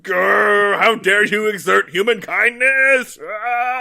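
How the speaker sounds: hammed-up furious old crank roaring; mock outrage. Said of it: "Ben gets so mad at her".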